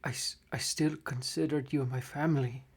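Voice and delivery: breathless whisper of a dying gnome. Acted. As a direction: fade out on his last breath. Dying gnome's last words.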